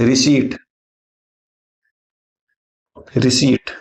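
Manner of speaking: "रिसीट" said alone, long ee, no p; careful correct pronunciation. Shown as a demonstration. Teacher modelling the bill word for learners, carefully pronounced. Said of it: "ध्यान रखिएगा, ये रिसीट होता है".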